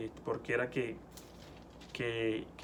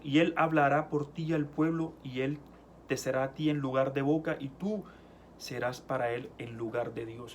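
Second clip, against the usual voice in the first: preaching at full force. Acted. Preacher reading Exodus to the congregation, full-force preaching. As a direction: proclaim the verses as God's rebuke. Scripture reading resumed after perdón.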